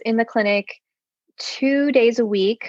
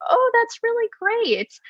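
high, mild mock-polite approval; lightly voiced, higher-pitched underwhelmed reaction; underwhelmed dad; 13 semitones higher than usual. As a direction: imitate a dad's token enthusiasm. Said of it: "he wasn't that excited".

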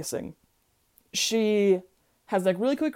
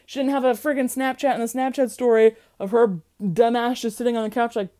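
ordinary talk

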